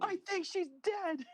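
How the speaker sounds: mock movie line. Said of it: "mocking movie dialogue".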